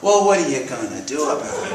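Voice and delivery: defiant comeback, retold. Retold golfer taunting back.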